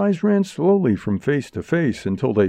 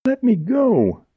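high, drawn-out plea; pleading high-pitched cry; 13 semitones higher, slower than usual. A narrator's reading. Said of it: "cried Jerry".

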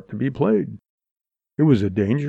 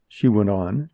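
plain reading voice

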